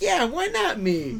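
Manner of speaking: put-on high, slow eager kid voice; childlike eagerness. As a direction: play the keen eleven-year-old. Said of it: "You're like".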